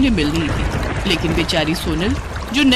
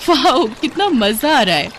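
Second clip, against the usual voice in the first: higher-pitched, thrilled outburst; delighted exclamation; 5 semitones higher than usual. Acted. Daughter-in-law enjoying washing at the Yamuna ghat, delighted. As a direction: gush with delight at the fun.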